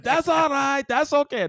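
loud, high-pitched mock Cuban accent; put-on Cuban accent. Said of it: "So that was a Cuban accent".

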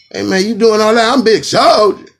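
high, loud cocky yell. Retold or imitated. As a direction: puff up and shout it. Acted [name] approaching.

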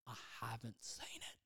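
a sheepish whispered admission. Real, not acted. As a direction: whisper it, a little embarrassed. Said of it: "That's the third time".

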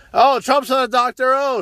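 high, sneering mock of the press; a put-on voice to mock the critics; snooty mocking quote of the media.

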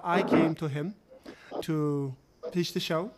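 ordinary delivery

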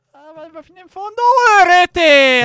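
rising, fast goal call; excited shout. Real, not acted. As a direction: yell as the goal goes in. Goal being scored.

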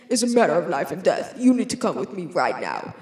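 low, brisk dramatic movie-man voice; man with urgent warning. Acted. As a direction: deliver a life-or-death line, low and hurried. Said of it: "he's like".

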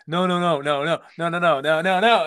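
loud, rapid string of refusals; insistent interrupting. Acted. Co-hosts fighting over who talks, insistent interrupting. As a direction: butt in loudly, rising at the end. Acting out both talking at once.

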